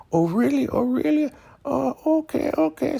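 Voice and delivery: high falsetto reaction; mock surprised woman. Elder Mother hearing she's forgiven, high mock surprise. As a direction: imitate a squeaky, wondering woman.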